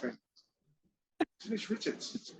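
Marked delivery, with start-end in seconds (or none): 1.4-2.3 awed whisper. Retold or imitated. Starstruck gasp at her entrance.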